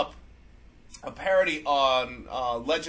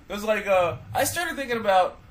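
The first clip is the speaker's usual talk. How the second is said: ordinary delivery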